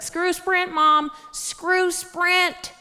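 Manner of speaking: high-pitched rallying cry; fired up. Retold or imitated. Daughter rallies mom against phone company, high fired-up cry. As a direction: egg mom on, drawing out the end. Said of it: "I got her all fired up".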